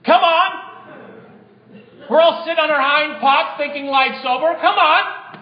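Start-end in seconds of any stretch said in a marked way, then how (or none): 0.0-0.6 rousing call. Real, not acted. Preacher rouses the congregation. 4.5-5.4 higher and louder, urging the crowd on; rousing shout. Real, not acted. Preacher rouses the congregation.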